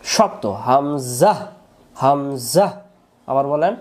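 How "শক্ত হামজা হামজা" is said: slow হামজা with a firm throat catch at the start; hard hamza. Shown as a demonstration. Teacher demonstrating the hard hamza for students. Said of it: "শক্ত করে পড়তে হয়".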